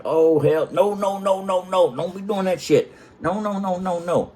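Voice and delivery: high, insistent string of no's; exasperated refusal. Retold or imitated. Retold refusal after wife farts again.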